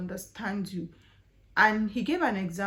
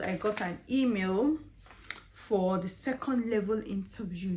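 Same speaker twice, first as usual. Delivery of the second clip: normal talking voice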